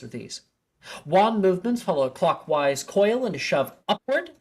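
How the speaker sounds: the DADA professor introducing Expelliarmus; professor lecturing. Acted. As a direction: announce the spell to the class with flair. Professor addresses the class.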